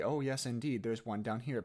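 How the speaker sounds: ordinary delivery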